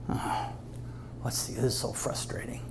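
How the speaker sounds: hushed, frustrated hiss of the tempter; frustrated whisper. Acted. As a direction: whisper it like a foiled devil. Satan frustrated, whispered aside.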